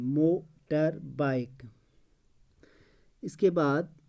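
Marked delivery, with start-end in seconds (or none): 0.0-1.6 motor bike slowly, mo, tar, bike, with gaps; syllable by syllable. Shown as a demonstration. Teacher showing the word has three syllables, syllable by syllable. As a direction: break motor bike into three separate beats. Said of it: "motor bike में तीन".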